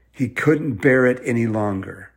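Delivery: ordinary delivery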